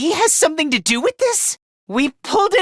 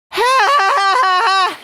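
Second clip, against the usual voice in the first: boastful laugh; 5 semitones higher than usual. Acted. Denying overexertion in a contest, high forced laugh.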